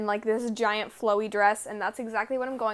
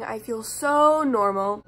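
dry deadpan sarcasm; sarcastic; 3 semitones lower, slower than usual. Acted. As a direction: say it with heavy irony. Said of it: "That was sarcastic".

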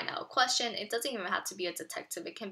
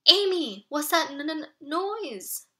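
scared; 6 semitones higher, 7 dB louder, slower than usual. Acted. Scared boy stammers to sister.

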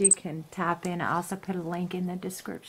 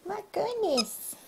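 cooing; 12 semitones higher than usual. Real, not acted. Mom coos at the baby.

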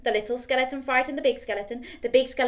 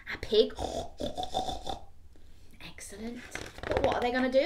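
ordinary delivery

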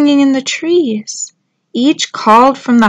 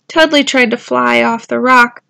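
plain reading voice

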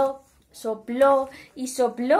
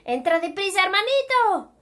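urgent welcome; 8 semitones higher, 3 dB louder than usual. A narrator's reading. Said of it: "le dijo Nuf Nuf".

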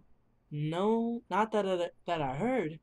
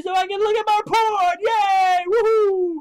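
high, loud whooping; loud cheer; 9 dB louder than usual. Real, not acted. Gamer cheering about the laptop, loud whoop. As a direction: cheer at the top of your lungs. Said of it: "nobody heard me".

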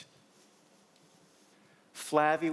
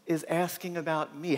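normal talking voice

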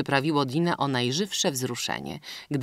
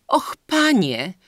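slow, high, louder cry of indignation; majestic exclamation; 7 semitones higher, 7 dB louder, slower than usual. A narrator's reading. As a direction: exclaim with wounded dignity, slowly. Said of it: "wykrzyknęła majestatycznie Dina".

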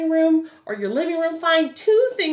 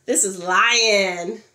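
slow sign demo; 5 semitones lower, much slower than usual. Shown as a demonstration. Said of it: "You can make that face".

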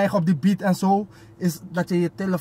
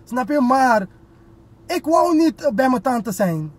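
higher and louder, heated; raised, emotional; 7 semitones higher, 4 dB louder than usual. Real, not acted. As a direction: insist with feeling, pitch climbing. Emotional about mother.

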